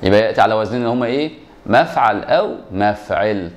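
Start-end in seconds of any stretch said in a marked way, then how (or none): 1.7-3.5 slow spelled-out patterns. Shown as a demonstration. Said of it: "ركز معايا في الوزن الأول".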